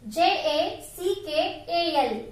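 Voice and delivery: slow spelling of J A C K A L; spelled out letter by letter. Shown as a demonstration. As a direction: spell J A C K A L one letter at a time.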